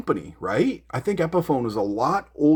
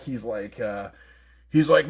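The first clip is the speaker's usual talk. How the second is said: ordinary delivery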